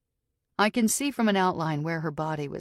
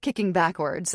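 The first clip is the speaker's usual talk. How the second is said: ordinary delivery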